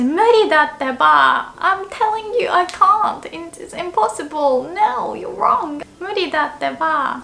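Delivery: high, whiny protest; insisting I can't. Shown as a demonstration. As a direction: plead that it's impossible. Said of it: "I would say".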